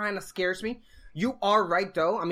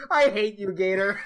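real laughter bursting through, louder voice; laughing hard; 4 semitones higher, 6 dB louder than usual. Real, not acted. Friend cracking up at Gator's card idea, laughing hard. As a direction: protest loudly while cracking up. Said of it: "I'm laughing so hard".

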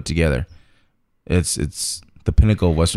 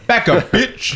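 mock tough-guy line; 13 semitones higher, much slower than usual.

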